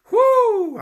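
high, loud joyful whoop; spontaneous cry of praise. Real, not acted. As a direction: let out a high happy whoop. Preacher fired up.

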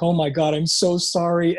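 ordinary delivery

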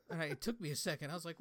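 usual speaking voice

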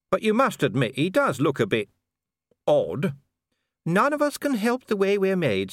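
high-pitched lady, gently reproving; high voice, excusing someone's looks; lady chiding her husband, pitched high; well-meaning lady. Acted. Voices Lady Sybil in narration.

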